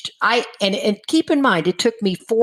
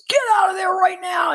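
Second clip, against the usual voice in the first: high urgent shout, close to a cry; retold yell; 13 semitones higher than usual. AA sponsor yelling down the phone, urgent shout. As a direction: yell it at a friend about to drink. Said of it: "he started yelling at me".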